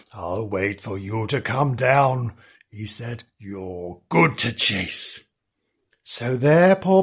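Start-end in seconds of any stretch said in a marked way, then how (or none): 0.1-3.2 low, slow, softer threat; unhurried low menace; menacing dog. A narrator's reading. Dog threatens treed kitten. 3.4-5.2 low, very slow relish; menacing dog. A narrator's reading. Dog threatens treed kitten.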